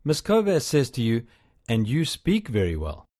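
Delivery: ordinary delivery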